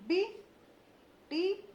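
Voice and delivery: बिटिया slowly, each syllable apart; slow spelled-out बिटिया. Shown as a demonstration. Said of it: "किस प्रकार से मैं ... उच्चारण".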